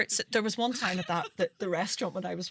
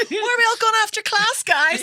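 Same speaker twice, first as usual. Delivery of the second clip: high, loud keen voice, laughing; bright mock eagerness; eager mature student; 9 dB louder than usual. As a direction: chirp it like an overkeen mature student. Mocking her eager older self.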